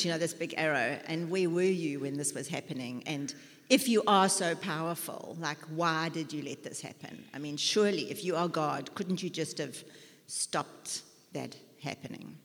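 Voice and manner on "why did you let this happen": ordinary talk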